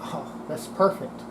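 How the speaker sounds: ordinary delivery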